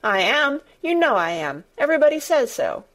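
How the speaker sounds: high, indignant insistence; mildly outraged outburst; crying out. A narrator's reading. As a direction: protest it hotly, like an offended child. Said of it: "he cried".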